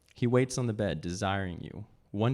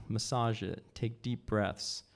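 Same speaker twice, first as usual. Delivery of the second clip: ordinary delivery, nothing marked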